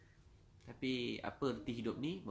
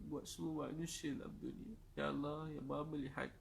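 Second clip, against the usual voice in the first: high-pitched, pleading supplication; real, aching plea for mercy; imploring prayer; 6 semitones higher than usual. Real, not acted. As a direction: beg God from the heart, voice lifted high. Begs God not to reject them.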